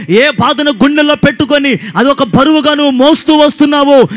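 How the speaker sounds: loud, high, fervent preaching to the crowd; full-force preaching. Acted. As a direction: preach it out, building and calling hallelujah. (బిగ్గరగా, ఉత్సాహంగా ప్రసంగం)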